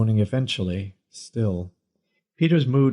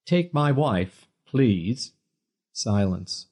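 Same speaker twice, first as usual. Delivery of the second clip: game comedian; 4 semitones higher than usual. A narrator's reading. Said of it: "he declared gamely".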